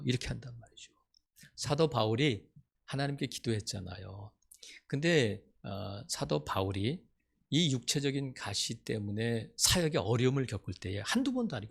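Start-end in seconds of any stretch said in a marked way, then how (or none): none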